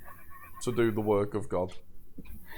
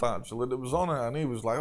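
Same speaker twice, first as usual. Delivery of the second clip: normal talking voice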